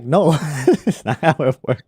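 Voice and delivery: laughing denial. Retold or imitated. Streamer retelling his reply to worried viewers, laughing denial. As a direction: break into laughter while setting them straight.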